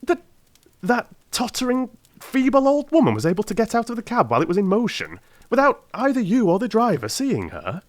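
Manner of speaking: put-on can't believe an old woman escaped; amazed. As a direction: Watson pressing Holmes in disbelief. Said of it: "I cried in amazement".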